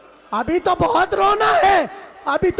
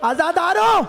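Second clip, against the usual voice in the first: ordinary delivery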